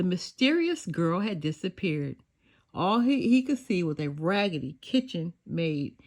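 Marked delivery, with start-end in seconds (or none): none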